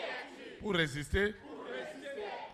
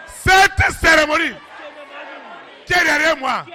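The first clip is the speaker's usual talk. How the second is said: proclaiming shout; 8 semitones higher, 5 dB louder than usual. Acted. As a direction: declare it like a verdict. Preacher proclaiming loudly.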